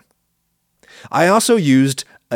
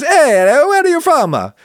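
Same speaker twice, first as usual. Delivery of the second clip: much higher, louder cartoon Italian accent; Mario accent; 5 dB louder than usual. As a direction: call out in an over-the-top Italian accent. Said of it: "Sorry for the Mario accent".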